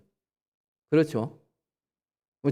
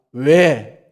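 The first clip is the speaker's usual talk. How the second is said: father's sharp why; 7 dB louder than usual. Retold or imitated. Father questions child's request.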